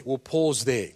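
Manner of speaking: ordinary talk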